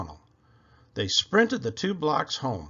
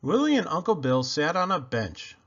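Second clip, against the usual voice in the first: usual speaking voice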